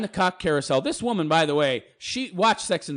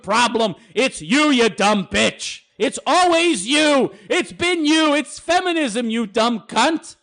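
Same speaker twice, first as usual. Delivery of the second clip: much higher, slow, furious yelling as her inner voice; harsh inner voice; 7 semitones higher, 4 dB louder, slower than usual. Pretend. Her inner voice yelling at her, slow, high and furious. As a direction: berate her like a furious conscience. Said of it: "that little voice is reality".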